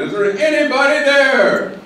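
high, loud desperate call into the void; a man hanging over a cliff calling for help. Acted. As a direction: shout it out over the abyss, hoping someone hears. Joke, man calling out again.

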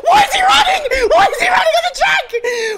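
very high shrieking, breaking into laughter; hysterical disbelief. Real, not acted. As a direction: scream incredulously through laughter. Hysterical at pit chaos.

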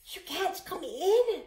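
put-on an old woman urgently turning the boy away; old woman warning. A narrator's reading. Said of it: "she whispered".

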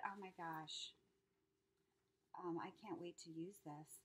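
ordinary delivery

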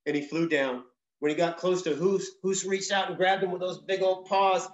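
usual speaking voice

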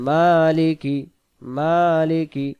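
মালিকি chanted with a long "maa"; one-alif stretch. Shown as a demonstration. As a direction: draw out the first syllable of মালিকি. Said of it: "এক আলিফ টান হবে".

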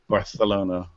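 Barcelona with a Spanish lisp, "Barthelona". Shown as a demonstration. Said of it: "so I have to say it with a lisp".

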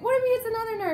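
high-pitched retold panic; re-enacted anxious squeal; freaking out. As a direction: fret in a high, alarmed voice. Acts out freaking-out thought.

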